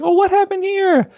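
very high, sing-song kindly coach; concerned coach. Retold or imitated. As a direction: chirp it like a sweet kids' coach. Coach voiced in retold story.

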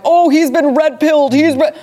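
high, loud mocking parody of an eager fan. Mocking the fans who think he's converted, high, loud parody. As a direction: sneer it out like a gushing believer. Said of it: "I know everyone wants to go like".

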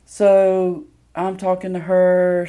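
ordinary talk

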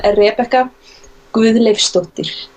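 slow, careful Icelandic pronunciation; Icelandic accent. Shown as a demonstration. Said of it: "I'm gonna say my full name with an Icelandic accent".

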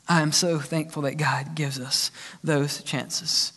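hushed, whispered and slow; a whispered personal confession to the congregation. Real, not acted. Pastor whispers his thanks to the congregation, hushed and slow.

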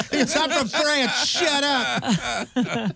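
high, brash New York accent mock. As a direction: snap it like a brash New Yorker. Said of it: "heaviest New York accent I've ever heard".

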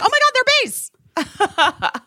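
high, slow delighted gasp; excited gush. Real, not acted. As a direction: swoon over the cute bags. Excited shopping exclamation.

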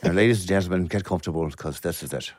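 low, softening Irish accent. Shown as a demonstration. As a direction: play an Irish host settling the audience in. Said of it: "do the rest of the podcast just like this".

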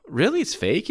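imitating his kid self hearing it's fake; a retold, stunned little question; disbelieving kid. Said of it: "And I was like".

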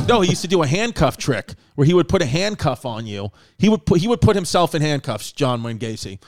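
usual speaking voice

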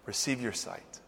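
low, very soft pronouncement; hushed, quiet word of healing; gentle granting. Retold or imitated. Jesus heals the blind man, softly.

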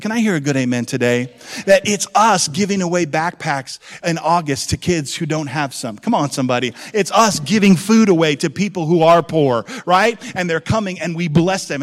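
loud, rousing preacher; rally the congregation loudly; full-force preaching. Acted.

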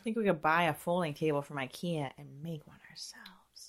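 soft unvoiced whisper. Real, not acted. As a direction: whisper the idea quietly. Whispered suggestion.

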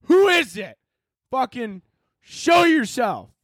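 high frightened yell, slowing at the end; scared shout. Retold or imitated. Startled homeowner shouting at an unseen intruder, high scared yell. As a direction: call out at a burglar you cannot see. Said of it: "And I'd be like".

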